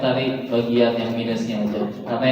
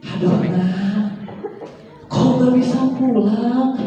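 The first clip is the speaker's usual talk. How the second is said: put-on higher, slow frail old mother's voice; frail old mother missing her child; 7 semitones higher, much slower than usual. Shown as a demonstration. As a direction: plead like a weak, aging mother. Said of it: "saya tahu ibunya udah renta".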